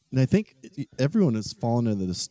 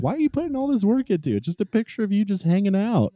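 much higher, fast incredulous quote; baffled disbelief; 12 semitones higher, faster than usual. Retold or imitated. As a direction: squeal it out in exasperated disbelief. Mocking an ex's photo editing.

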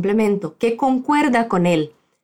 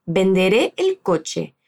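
"Venderé el coche" spoken slowly and evenly; slow model phrase; 5 semitones lower, slower than usual. Shown as a demonstration. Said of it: "Yo podría decirte".

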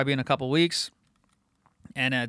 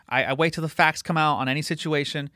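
normal talking voice

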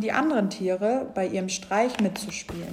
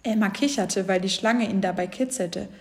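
usual speaking voice

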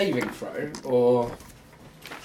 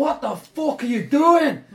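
outraged high shout, drawn out; Gretsch Shotsinker; 12 semitones higher, 10 dB louder, slower than usual. Acted. Game master as villain seeing his man burn, outraged shout. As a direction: bellow it slow and high in disbelief. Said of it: "you hear Gretsch Shotsinker just go".

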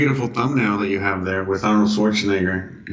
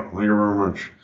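[name] voice; 2 semitones lower, 3 dB quieter than usual. Retold or imitated. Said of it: "[name], right?".

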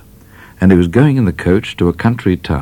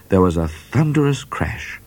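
ordinary delivery, nothing marked

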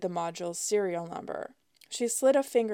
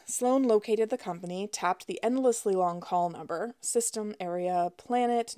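usual speaking voice

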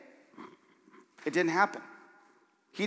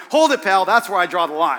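hammed-up indignant retort; mock indignation; 8 dB louder than usual. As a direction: playfully put your foot down, loudly. Acted indignant retort to critic.

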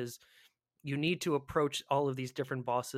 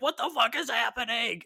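higher, startled What the fuck; panicked confusion; 9 semitones higher, 4 dB louder than usual. Retold or imitated. Retelling being ambushed by Killa, panicked and startled. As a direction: caught off guard, imitating a bewildered player.

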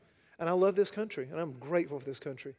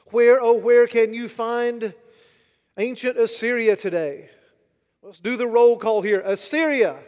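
preaching at full force; 4 semitones higher, 10 dB louder than usual. Acted. Preacher to congregation, full-force preaching. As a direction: hammer the point home to the congregation. Preaching history of fallen nations.

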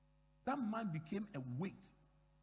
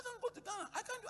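much higher, urgent protest on the phone; retelling how he raised his voice at the embassy lady; faster than usual. Retold or imitated. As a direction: push the pitch right up in frustration. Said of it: "I raised my voice".

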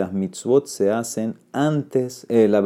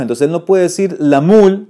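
emphatic point; 7 semitones higher, 6 dB louder than usual. Real, not acted. Teacher pressing his argument.

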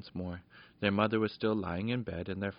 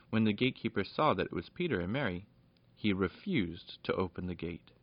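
usual speaking voice

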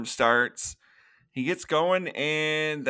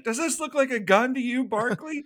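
mildly incredulous, pitched-up imitation of a telling-off; retold scolding question with raised pitch; mock scolding; 10 semitones higher than usual. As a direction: imitate an officer challenging a cadet, higher. Retold officer scolding a cadet.

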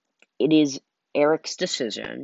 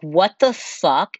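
higher, louder, slow stunned outburst; shocked; 7 semitones higher, 8 dB louder, slower than usual. Retold or imitated. Hearing from mom it's evening, slow loud shocked outburst. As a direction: drag it out in disbelief. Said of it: "and I'm just like".

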